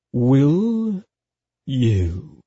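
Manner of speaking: slow, teasing menace; silky, seductive threat; delicious menace. A narrator's reading. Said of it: "he said slowly and softly, and full of delicious menace".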